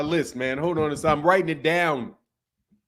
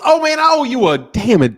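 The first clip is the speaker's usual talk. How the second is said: sudden high, loud realization; surprised outburst; 7 semitones higher, 10 dB louder than usual. Real, not acted. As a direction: blurt it as it hits you. Suddenly remembers a debt.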